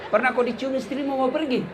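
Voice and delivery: ordinary delivery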